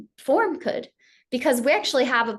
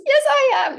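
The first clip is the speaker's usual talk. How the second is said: very high, louder squeaky insistence; stubborn sheep lion; 14 semitones higher, 4 dB louder than usual. Retold or imitated. Cub insists to the lion in a very high squeak. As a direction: dig in like a stubborn little kid. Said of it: "And he says".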